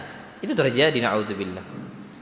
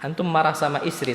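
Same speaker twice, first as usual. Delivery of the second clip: ordinary delivery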